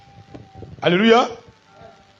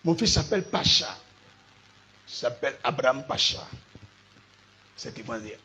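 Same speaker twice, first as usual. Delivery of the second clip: normal talking voice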